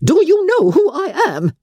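very high, shocked indignation; scandalised aristocrat; affronted Lady Catherine. Acted. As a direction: pull rank in outrage.

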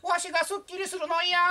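北村先生. Acted. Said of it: "怖い先生でした". (怖い体育教師の甲高い怒鳴り声)

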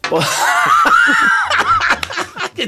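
high burst of laughter. Real, not acted. Laughs at a joke.

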